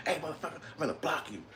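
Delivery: high, quick, quiet pretend threat; mock anger. Supposed to be mad at a commenter, mock angry. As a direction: fake being mad, squeezed high and fast. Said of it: "I'm supposed to be angry".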